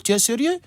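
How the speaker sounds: sérieux on a high rising question tune; are you serious, rising; questioning rise. Shown as a demonstration. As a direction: ask it back in disbelief. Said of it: "C'est comme si tu poses une question".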